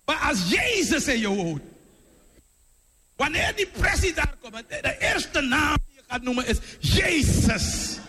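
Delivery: high, shouted preacher's delivery; full-force preaching. Real, not acted. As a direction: build to a ringing shout on Jezus. (vurig, luid preken)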